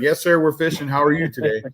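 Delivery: retold polite reply. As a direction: act out a polite "yes, sir". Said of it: "my answer is like".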